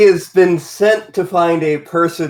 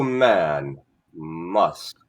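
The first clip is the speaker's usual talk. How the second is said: low smug creature voice; 10 semitones lower, 6 dB quieter than usual. Acted. [name] in character.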